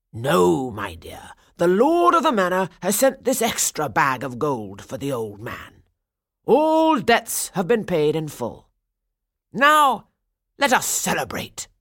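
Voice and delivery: loud, hearty official voice; jovial burgomaster. A narrator's reading. Burgomaster announcing the debts paid, hearty and loud. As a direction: proclaim good news to all.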